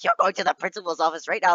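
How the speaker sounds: mildly imitated angry teacher; mock teacher yelling. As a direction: lightly mimic a teacher giving orders. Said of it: "she was yelling at me".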